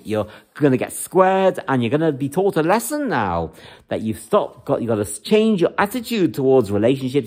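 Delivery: ordinary delivery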